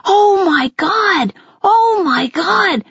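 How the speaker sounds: slow, high, gushing exclamations; overjoyed mom's drawn-out mild scream; screaming with excitement. A narrator's reading. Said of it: "Mom was screaming with excitement".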